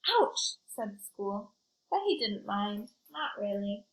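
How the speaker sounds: put-on lively read-aloud for kids; story narration. A narrator's reading. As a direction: tell it like a picture book to a class. Reads picture book to children.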